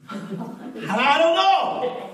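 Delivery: high, slow, drawn-out I don't know; Job's bewildered answer. Acted. Job answering why he suffered, high and slow. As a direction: let Job's helpless answer stretch out.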